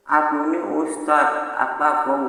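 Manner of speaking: a hint of a boastful ustadz; mock ustadz. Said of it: "Masa ustadz ngomongnya begitu?".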